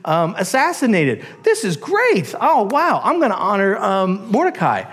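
high, surprised realization; excited royal discovery; delighted king. Acted. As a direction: gush like a king just remembering. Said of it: "And he's like".

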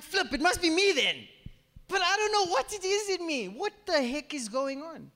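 high-pitched, baffled outburst; exasperated self-blame. Retold or imitated. Preacher questioning what is wrong in oneself, exasperated. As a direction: wail the question in frustration, voice way up.

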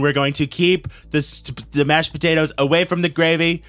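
hammed-up campaign promise; Donald Trump. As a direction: work the crowd as a mock politician. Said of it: "it's Donald Trump".

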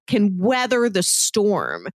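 ordinary delivery

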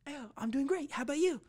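mock cheerful greeting; fake friendly voice. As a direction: put on a chirpy polite persona. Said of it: "I was just gonna be like".

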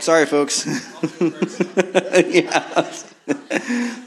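giggling apology; laughing. Real, not acted. Still laughing at the mishap.

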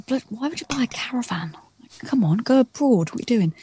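hushed unvoiced whisper, mock disbelief; whispered scorn. To her sister about buying a caravan, whispered scorn. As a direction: whisper it like a sneering aside. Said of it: "I was like".

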